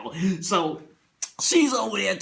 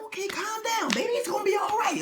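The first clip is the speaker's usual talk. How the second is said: soothing wife voice; 14 semitones higher than usual. Retold or imitated. Wife soothing her husband. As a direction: coo him down like a child. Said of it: "She was like".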